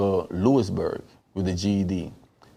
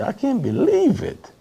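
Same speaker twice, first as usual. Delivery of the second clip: amazed; 3 dB louder than usual. Retold or imitated. Reacting to news about prison events.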